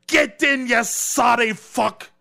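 furious Scottish shout, high and loud, slow on the curse; hammed-up angry Scottish shout; mock Scottish accent. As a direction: roar it like a raging Scot. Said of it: "You're getting so much better at that".

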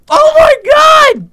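slow, loud shocked outcry; shocked shout. Acted. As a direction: yell out in disgust. Gnome drinks strange bubbly water.

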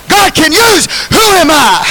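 high shouted call and response; preaching call. Real, not acted. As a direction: lift it high for the crowd. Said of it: "help me preach".

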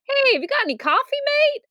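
high, quick mock American accent; American guy. American customer asking shop worker, high American accent. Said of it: "An American guy came in".

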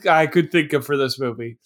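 usual speaking voice

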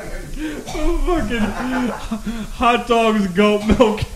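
laughing through the words. Real, not acted. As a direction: crack up listing it. Laughing at gross ingredients.

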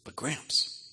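softly voiced little girl, confiding; imitated confiding granddaughter. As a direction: lean in softly with a hint of a child's voice. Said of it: "she leans in and she goes".

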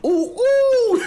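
squealing "Ooh, ooh!" very high, loud and laughing; excited squeal. Retold or imitated. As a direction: squeal with delight at the back of the room. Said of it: "be like".

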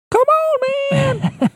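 thrilled, squealing "come on, man"; excited. Real, not acted. Excited about a guitar feature.

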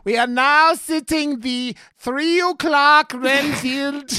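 slow, loud, high mock-Transylvanian announcement; horrible Transylvanian accent. Imitating the Alamo worker seating Renfield, over-the-top Transylvanian accent. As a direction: stretch out a cheesy, German-tinged Transylvanian accent. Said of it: "horrible Transylvanian accent and is like".

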